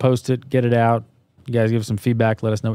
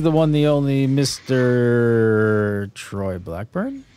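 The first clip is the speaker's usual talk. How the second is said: announcer introduction; much slower than usual. Acted. Introducing cohost, drawn out.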